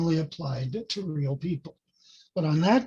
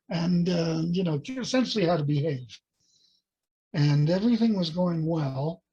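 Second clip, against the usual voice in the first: usual speaking voice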